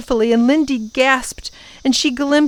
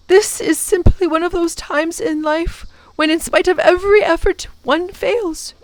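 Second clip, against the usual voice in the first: put-on higher, gentle old professor, sorrowful; sad old professor; 7 semitones higher than usual. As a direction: voice the disappointed professor consoling the children. Professor voiced in the story.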